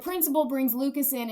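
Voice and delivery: usual speaking voice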